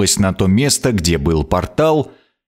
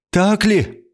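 a queen's voice pitched higher, haughty; 11 semitones higher than usual. A narrator's reading. Queen challenges his claim of power, haughty. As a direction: look down on him as you say it. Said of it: "Она посмотрела со всей надменностью".

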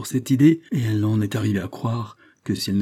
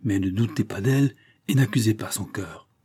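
ordinary delivery, nothing marked

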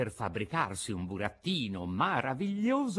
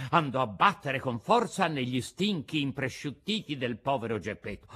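ordinary delivery, nothing marked

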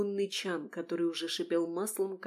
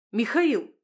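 louder, higher exclamation of his name; indignant call; 4 semitones higher, 8 dB louder than usual. A narrator's reading. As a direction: call him out sharply. Chef shouts at messy colleague.

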